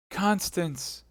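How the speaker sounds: high, anguished calling; calling her back; mournful cry; grief-stricken cry. Acted. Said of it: "cried D'Artagnan".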